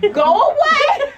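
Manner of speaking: high, loud, drawn-out yell; mock drunk yell. Imitating drunk friend shouting away drivers, high slow mock yell. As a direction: bellow it slowly, laughing. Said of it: "she'd be like".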